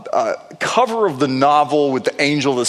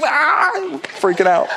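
ordinary talk

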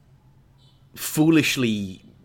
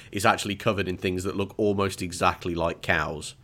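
ordinary talk, nothing marked

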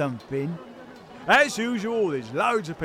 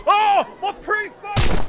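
high pained squeal; 9 dB louder than usual. Acted. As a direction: shriek high in mock pain. Crowd member cries out.

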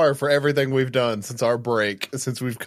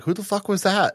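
higher-pitched mock bafflement; baffled relatives; 9 semitones higher than usual. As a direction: imitate the friend's bewildered family. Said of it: "just be like".

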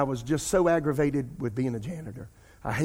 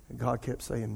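usual speaking voice

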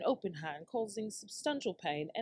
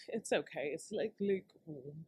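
ordinary talk, nothing marked

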